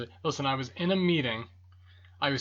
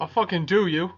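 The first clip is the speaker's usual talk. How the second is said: louder playful threat, pushed harder; louder mock threat; 6 semitones higher, 8 dB louder than usual.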